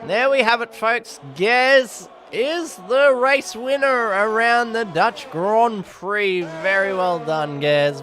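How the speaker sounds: high, loud finish-line announcement; big race-caller flourish, easing at the end; triumphant winner call. Real, not acted. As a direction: draw it out as you crown the winner.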